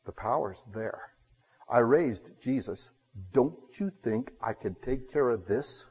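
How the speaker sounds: low, quiet, measured authority; softly voiced almighty rebuke; God. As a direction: answer calmly, unhurried and sure. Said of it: "And God goes".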